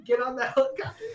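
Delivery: half-collapsed comic command; laughing mock order. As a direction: bark it through laughter. Repeats the yelled order, laughing.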